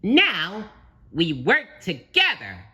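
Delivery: high, loud eager cartoon voice; exclaiming. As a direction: announce the plan with gusto. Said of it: "he exclaimed".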